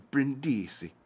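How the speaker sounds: Brindisi in a put-on Italian accent. Shown as a demonstration. Host trying to get the Italian town's name right, Italian accent. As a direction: say it as an Italian would. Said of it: "trying to get the accent right".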